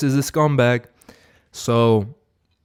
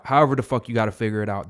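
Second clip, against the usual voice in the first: ordinary talk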